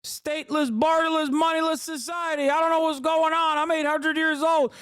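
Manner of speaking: put-on very high, strained old-man caricature; hammed-up senile president impression; Joe Biden. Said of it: "and then Joe Biden would go".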